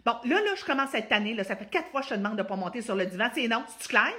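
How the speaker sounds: loud, fed-up scolding; irritated mom. Shown as a demonstration. Mother losing patience with Juliette, loud irritated scolding. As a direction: snap at the child, fed up. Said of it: "je suis dans l'irritation".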